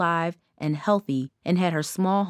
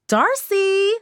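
high sing-song call, much higher and louder; grating sing-song call; 13 semitones higher, 5 dB louder than usual. A narrator's reading. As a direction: sing the name out like a teasing chant. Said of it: "A grating, sing-songy voice".

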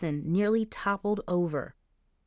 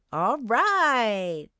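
cheering; 4 semitones higher, 4 dB louder than usual. Acted. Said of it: "Charisse cheered with a wide grin".

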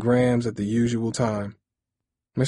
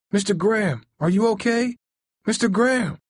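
high, frightened calling of his name; panicked; 12 semitones higher, 3 dB louder than usual. Acted. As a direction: call out in alarm, much higher. Boy finds man collapsed.

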